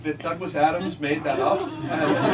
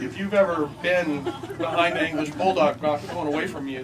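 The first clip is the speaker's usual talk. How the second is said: usual speaking voice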